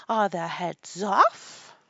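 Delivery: loud, drawn-out royal demand; shouted question. A narrator's reading. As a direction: shout it out slowly as queen. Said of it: "shouted the Queen".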